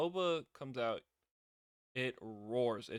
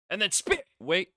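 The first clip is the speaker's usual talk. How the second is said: much higher and louder burst; excited outburst; 8 semitones higher, 14 dB louder than usual. Real, not acted. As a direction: blurt it out in disbelief. Excited recap of spit.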